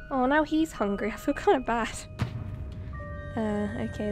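ordinary delivery

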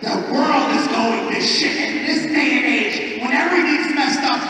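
loud, high-pitched speech in defense of the show; impassioned rant. Retold or imitated. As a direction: rant it like you're saving the world.

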